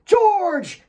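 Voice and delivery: a big bellow of a name, high and loud. A narrator's reading. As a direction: bellow for George across the yard. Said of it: "she bellowed".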